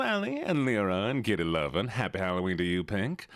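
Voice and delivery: put-on Southern streamer voice; easygoing Southern accent. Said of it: "Southern Voice Redeem".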